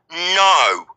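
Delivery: ordinary delivery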